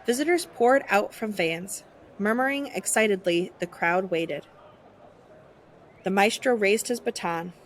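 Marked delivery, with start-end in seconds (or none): none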